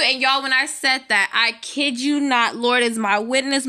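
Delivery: normal talking voice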